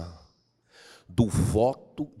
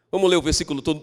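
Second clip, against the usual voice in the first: ordinary talk, nothing marked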